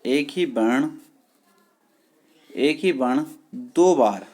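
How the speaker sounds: drawn-out dictation, word by word; slow dictation. Shown as a demonstration. Teacher dictating a definition to students, drawn out. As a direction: spell it out slowly for writing.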